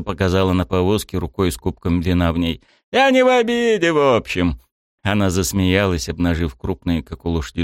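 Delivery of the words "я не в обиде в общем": booming baroness. A narrator's reading. Baroness shrugs it off.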